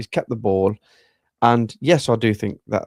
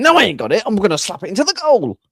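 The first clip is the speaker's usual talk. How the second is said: a higher, louder, hammed-up striker calling for the ball; mock striker's shout; 11 semitones higher, 5 dB louder, faster than usual. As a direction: play the striker yelling he'll slap it in. Retelling striker claiming the ball.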